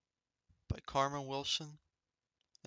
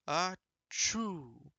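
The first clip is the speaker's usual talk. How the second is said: plain reading voice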